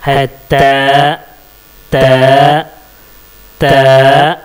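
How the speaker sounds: त with the tongue tip at the root of the upper teeth; a crisp dental त; Arabic letter ta. Shown as a demonstration. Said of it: "इसको कैसे pronounce करेंगे". (ज़ुबान की नोक दांत की जड़ पर, त)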